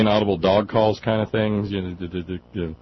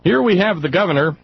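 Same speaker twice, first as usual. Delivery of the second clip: high, loud proclamation; ringing read-aloud voice; dramatic email reading; 11 semitones higher, 7 dB louder than usual. Acted. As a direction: announce it grandly, pitched up. Reads listener's email aloud.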